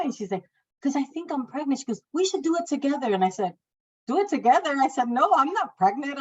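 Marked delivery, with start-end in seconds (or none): 0.8-3.1 coworker. Retold or imitated. Coworker urging pregnancy test. 4.1-6.2 incredulous. Retold or imitated. Refusing coworker's suggestion.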